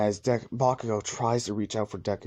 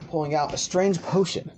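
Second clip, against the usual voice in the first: plain reading voice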